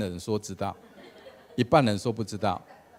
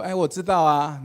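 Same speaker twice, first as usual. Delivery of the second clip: higher, louder call from the crowd; congregation calling back; 6 semitones higher, 7 dB louder than usual. Retold or imitated. The crowd calls out again that they know, higher and louder. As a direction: answer together like a playful audience.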